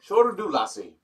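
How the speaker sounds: mock Scottish brogue, high; Scottish accent. Cohost teasing his partner in a mock Scottish accent. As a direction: lay on a playful Scottish lilt. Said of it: "Why are you talking like that?".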